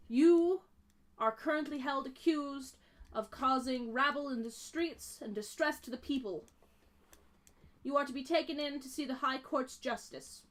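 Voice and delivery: commanding elf official, loud and formal; stern high elf. Acted. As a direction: proclaim the charges sternly.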